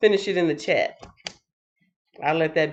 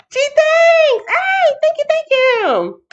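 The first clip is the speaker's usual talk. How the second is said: very high, loud squeal; excited thanks; 7 dB louder, slower than usual. Real, not acted. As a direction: gush thanks in a sky-high voice. Thanks a viewer effusively.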